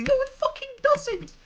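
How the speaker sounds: very high, loud retort; angry shout. Real, not acted. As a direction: yell it back in outrage. Answers the imagined defender.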